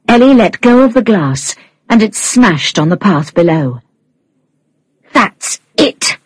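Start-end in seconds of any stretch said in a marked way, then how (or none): 5.1-6.2 furious. A narrator's reading. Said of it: "said Norma furiously".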